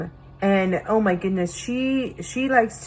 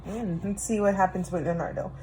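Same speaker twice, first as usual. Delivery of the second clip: ordinary delivery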